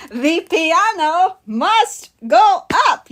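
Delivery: high, slow, word-by-word proclamation; mock cartoon quote. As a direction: declare it with hammed-up pauses between words. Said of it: "VeggieTales reference automatically wins".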